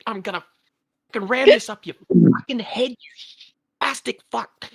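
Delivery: mock Aussie tirade. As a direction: rattle off insults in one angry torrent. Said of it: "it comes all out in one constant stream of vitriol".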